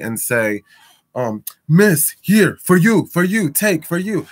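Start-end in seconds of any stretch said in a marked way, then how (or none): 1.7-4.3 higher and louder, choppy broken English; mock the pushy script they wanted; broken pushy sales pitch. Mocking the store's pushy sample pitch to customers, higher and louder. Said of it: "the worst English that I".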